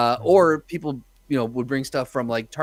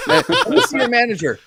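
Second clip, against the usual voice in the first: loud, much higher laughing mock whine; entitled customer; 12 semitones higher, 8 dB louder than usual. Customer demanding the manager, high and loud, mocking. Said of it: "customer's retort".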